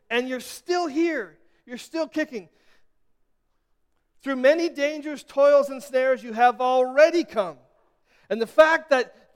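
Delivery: high, loud rising sermon climax; full-voiced preaching, building and insistent; preaching at full force. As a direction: drive it home to the congregation.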